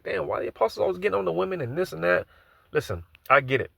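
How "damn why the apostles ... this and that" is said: higher-pitched quoted grumble; mock complaint. Man quoting what he used to ask about the apostles, mock complaint. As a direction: complain in a raised pitch, imitating an old self. Voices his own former complaint.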